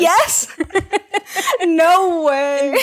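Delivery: high, loud exclamations with laughter; thrilled. Real, not acted. Co-host guessed the film right, thrilled shout. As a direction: burst out laughing in disbelief.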